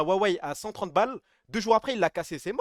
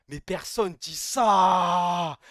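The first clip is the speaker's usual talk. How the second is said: very slow mock remark; 4 dB louder, much slower than usual. Mocking the odd pronunciation.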